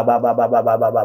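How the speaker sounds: usual speaking voice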